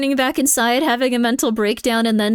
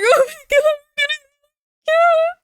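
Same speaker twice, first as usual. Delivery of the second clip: mock crying shriek; 4 dB louder than usual. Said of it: "they're going like".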